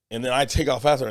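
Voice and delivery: ordinary talk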